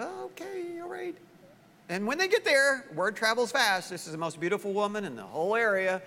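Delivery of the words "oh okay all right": high, soft, hesitant; a small, meek okay; wife giving in, high and hushed; Sarah agreeing. Acted. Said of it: "And she said".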